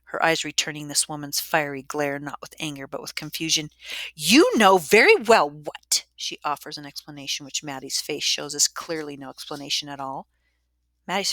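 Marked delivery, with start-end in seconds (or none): 4.1-6.1 loud, slow, higher-pitched scolding; angry accusation. A narrator's reading. Matron accusing confused Maddie, loud and angry. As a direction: bear down hard on each word.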